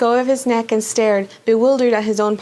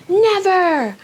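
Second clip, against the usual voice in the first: defiant shout; 8 semitones higher than usual. Acted. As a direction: fling a defiant no at the villain. Harry refuses Voldemort.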